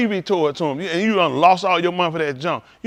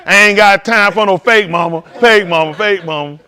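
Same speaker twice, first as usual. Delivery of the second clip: mock dismissive; 3 semitones higher, 7 dB louder than usual. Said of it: "they gonna come".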